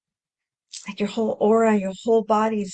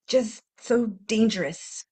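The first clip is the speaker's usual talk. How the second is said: usual speaking voice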